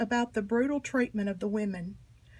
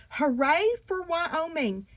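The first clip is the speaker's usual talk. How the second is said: cheering; 5 semitones higher, slower than usual. A narrator's reading. Narrator cheers a state victory.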